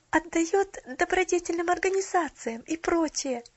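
put-on high, loud, insistent child voice; hurried pleading. A narrator's reading. Child holding the lady, hurriedly persuading the girl. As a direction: insist eagerly, tumbling from phrase to phrase. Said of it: "торопливо разговаривая с девушкой".

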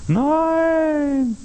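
long, high drawn-out cry of no; sweeping, stretched rejection; emphatic no. Real, not acted. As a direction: throw the word out high and long. Rejecting pious religiosity.

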